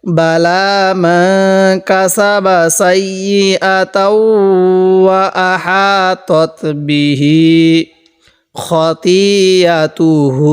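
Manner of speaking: slow, drawn-out reading of the verse; slow recitation. Shown as a demonstration. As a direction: stretch each syllable like recitation. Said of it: "এরকমভাবে শিখব". (ধীরে টেনে পড়া)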